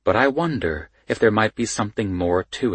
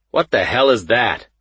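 mildly impatient gruff cop; short of patience; 4 semitones higher, 5 dB louder than usual. A narrator's reading. Sergeant questions an investigator's title, mildly short of patience. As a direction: show a touch of gruff impatience. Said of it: "clearly short of patience with me".

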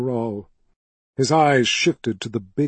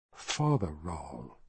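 soft correction; 7 semitones lower, 11 dB quieter, slower than usual. A narrator's reading. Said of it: "he corrected".

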